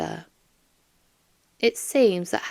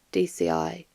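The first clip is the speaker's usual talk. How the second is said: ordinary delivery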